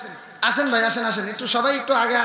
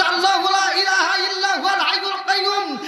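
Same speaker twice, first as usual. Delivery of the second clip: high, intoned Arabic verse; forceful Quran recitation; 7 semitones higher than usual. Real, not acted. Preacher quoting Allah's word to the crowd, reciting.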